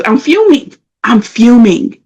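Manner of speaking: mildly heated retelling; retold fuming. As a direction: act out being worked up and irritated. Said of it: "I am fuming".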